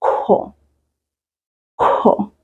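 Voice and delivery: "kho" with a breathy aspirated k; "Kho" unvoiced, strong aspiration. Shown as a demonstration. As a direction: push air on "Kho". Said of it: "I said".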